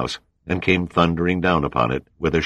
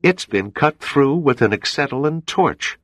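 high, raised shout; shocked shout; 10 semitones higher than usual. Acted. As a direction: cry it out in disbelief. Shocked ensign continues shouting.

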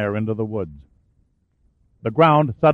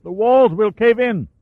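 high, raised warning shout; 10 semitones higher, 4 dB louder than usual. Acted. Caldweiler warning Shaw up the shaft, shouting. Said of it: "Caldweiler shouted up".

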